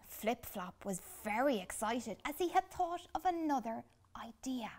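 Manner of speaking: ordinary delivery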